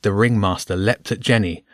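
ordinary delivery

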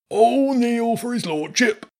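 high, slow, pompous herald call; herald announcing. A narrator's reading. Rat herald announcing his lordship to everyone. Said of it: "one of them announced".

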